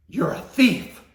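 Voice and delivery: high, loud accusing shout; furious mom. Retold or imitated. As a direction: hurl the word thief at him.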